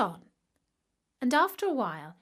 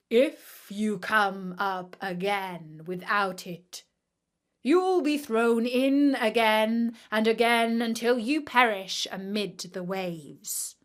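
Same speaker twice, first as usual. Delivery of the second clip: slow, louder stern royal warning; stern king; 5 dB louder than usual. Acted. The king warning the suitor at the sea, slow stern threat. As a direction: warn him coldly, weighing each word.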